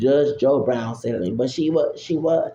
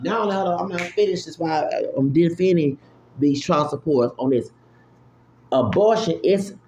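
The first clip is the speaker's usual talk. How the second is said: normal talking voice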